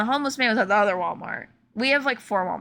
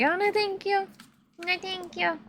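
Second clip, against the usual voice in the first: small, high-pitched no-thanks; acted timid politeness, pitched up; mock polite refusal; 8 semitones higher, 4 dB quieter than usual. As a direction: turn someone down in a tiny high voice.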